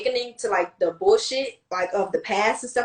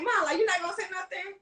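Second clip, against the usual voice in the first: pitched-up disbelieving complaint; high incredulous question; 9 semitones higher, 5 dB quieter than usual. Retold or imitated. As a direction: whine it to mom, high. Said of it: "And I was like".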